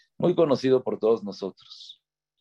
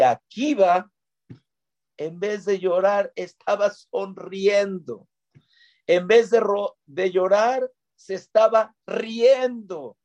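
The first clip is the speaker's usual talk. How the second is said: asombro narrado; 9 semitones higher, slower than usual. A narrator's reading. Contraste, Akiva sonríe.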